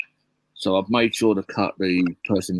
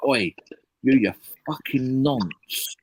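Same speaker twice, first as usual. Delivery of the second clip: slow, half-raised yell of abuse; retold shouting; 5 semitones higher, slower than usual. Driver recalling how he yelled at the cop, a mild mock shout. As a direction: call him out across the road, slowly. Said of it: "carried on shouting at him at the top of my voice".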